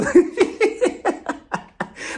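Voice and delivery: breathy high villain giggle; high scheming cackle. Pretend. Cackle after mock scheme.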